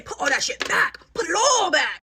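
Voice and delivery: high, loud scolding, building to a shout; stern mock command. Telling mom what to say, shouted.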